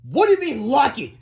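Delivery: high, loud huffy voice; indignant principal. A narrator's reading. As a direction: bristle at the word lucky. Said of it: "said Mister Krupp".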